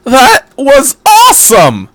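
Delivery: loud, high, slow gush of delight; excited declaration. A narrator's reading. Kensuke praising the lesson, excited and loud. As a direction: declare it like the best thing ever. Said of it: "Kensuke declared".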